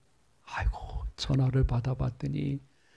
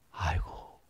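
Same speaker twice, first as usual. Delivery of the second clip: ordinary talk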